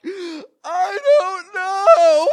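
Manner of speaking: very high, loud, slow wailing shout; bellowing. Retold or imitated. Child howls the answer at mother, sobbing. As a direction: wail it out through sobs. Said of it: "I bellowed".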